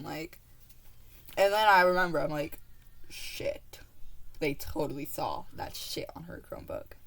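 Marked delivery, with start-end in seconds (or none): 3.1-7.0 panicked whisper. Retold or imitated. Sudden panicked realisation, inner thought.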